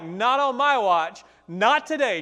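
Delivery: slow, loud and defiant, daring the giant; loud, slow battle cry of defiance; defiant declaration. Retold or imitated. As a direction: plant your feet and refuse him. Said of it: "And he was like".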